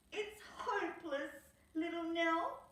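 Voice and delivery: high, hopeless-sounding Skip; Skip's voice. Acted. Skip telling Nell it is hopeless, high glum voice. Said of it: "said Skip".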